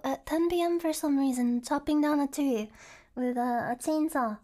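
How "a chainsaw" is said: normal talking voice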